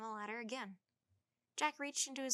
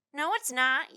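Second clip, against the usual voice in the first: put-on loud, high child's yell; 5 semitones higher, 15 dB louder than usual. A narrator's reading. Tad yells back at Jack from the ladder, loud stubborn yell. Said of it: "yelled Tad".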